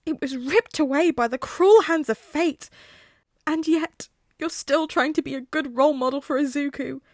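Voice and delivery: overflowing with earnest emotion; gushing hero. Acted. Tensei gushing emotionally at Hazashi.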